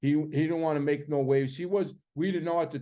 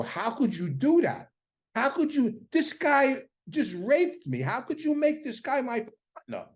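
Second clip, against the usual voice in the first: much higher, heated disbelief; irate outburst; 9 semitones higher than usual. Retold or imitated. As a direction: demand it, voice cracking upward in outrage. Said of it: "I'm so irate".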